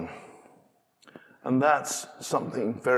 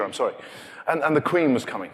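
ordinary delivery

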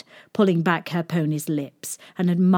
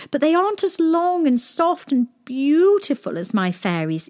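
princess voice; 8 semitones higher than usual. A narrator's reading. Princess boasting pony.

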